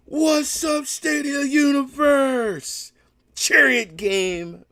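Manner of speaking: high, loud, drawn-out shout-out; excited greeting. Real, not acted. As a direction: call out to the fans with hype.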